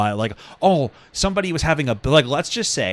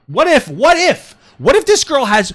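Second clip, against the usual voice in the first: loud emphatic; 11 semitones higher, 6 dB louder than usual. Real, not acted.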